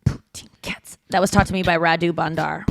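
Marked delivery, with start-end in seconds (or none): 0.1-1.0 beatboxing. Shown as a demonstration. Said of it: "All I know is poutine cats".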